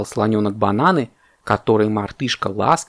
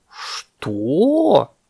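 high, drawn-out astonished question; shocked; 6 semitones higher than usual. A narrator's reading. Parrot learns bananas are being eaten, shocked. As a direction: swoop up in disbelief.

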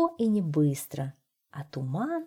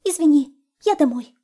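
high, loud hurried goodbye; frightened hare excusing itself and running; fleeing hare; 9 semitones higher, 9 dB louder than usual. A narrator's reading. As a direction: bolt away with the words. Hare flees home.

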